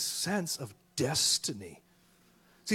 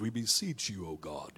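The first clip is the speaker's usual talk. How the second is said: lower, solemn cathedral tone; formal King James prayer; 10 semitones lower, 7 dB quieter than usual. Retold or imitated. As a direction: pray it in stiff old English. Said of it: "King James Version tones".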